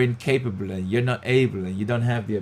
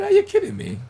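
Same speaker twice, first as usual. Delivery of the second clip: much higher, a disbelieving squeal; incredulous; 4 dB louder, faster than usual. Retold or imitated. Speaker retelling his reply to his mentor, incredulous. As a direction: sound like you can't believe your ears. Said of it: "I said".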